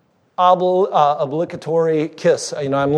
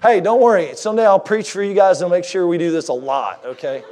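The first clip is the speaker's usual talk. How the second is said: usual speaking voice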